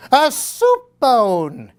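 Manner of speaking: high, slow delighted cry; an old lady crying out in delight; cried out. A narrator's reading. Old lady finds a bone, cries out high and slow. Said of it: "cried the little old lady".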